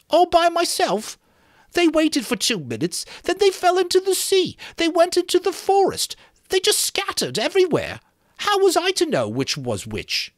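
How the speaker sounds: put-on high, far-off fretful boy's voice, well above the narrator; Piggy's whiny voice. Piggy scolding the boys for rushing off, high indignant boy voice.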